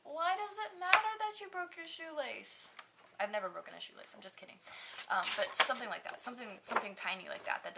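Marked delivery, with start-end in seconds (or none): 0.0-2.7 much higher, mock-baffled onlookers; higher-voiced parody of puzzled people staring at her; mocking bystanders. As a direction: squeak out their disbelief in a mocking voice. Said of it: "everybody's looking at me like".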